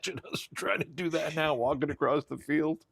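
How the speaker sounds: high, amused, laughing through it. Real, not acted. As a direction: giggle at the silly picture. Laughing at the idea.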